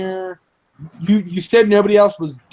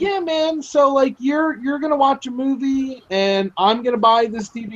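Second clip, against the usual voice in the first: casual friend voice; 7 semitones higher than usual. Retold or imitated. Imitating friend again, higher.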